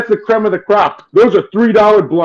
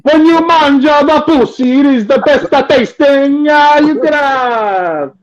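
hammed-up Italian accent, pitched higher; mock Italian accent; 6 semitones higher than usual. Joking with friends about food, in mock Italian accent. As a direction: lay on a cartoon Italian accent, slowly at times. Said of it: "canceled by the Italian Union".

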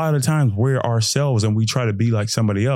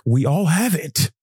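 ordinary delivery

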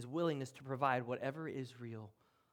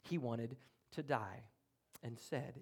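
usual speaking voice

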